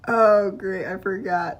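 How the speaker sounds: ordinary delivery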